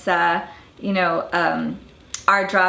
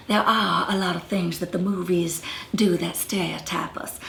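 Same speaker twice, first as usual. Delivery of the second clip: whisper; 4 dB quieter than usual. Real, not acted. Aside about movie stereotypes.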